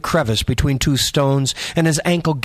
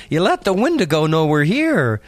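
put-on higher, youthful boy voice; teasing teen boy; 5 semitones higher than usual. A narrator's reading. Boy teasing the farter about the Wendigo, high youthful voice. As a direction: rib your buddy like a teenager.

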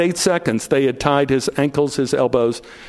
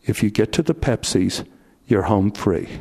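low inner voice; 7 semitones lower than usual. Retold or imitated. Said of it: "the voice came in my ear again and said".

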